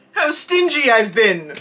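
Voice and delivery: regretful outcry; crying out. A narrator's reading. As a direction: Scrooge crying out in dismay. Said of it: "cries Scrooge".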